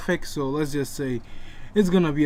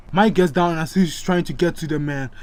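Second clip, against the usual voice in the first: plain reading voice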